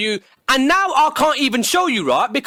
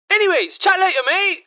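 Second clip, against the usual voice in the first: bright fake-friendly goodbye, higher; breezy dismissive farewell; mock cheery sign-off; 6 semitones higher than usual. Hanging up on a mate, mock cheery. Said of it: "by doing the following".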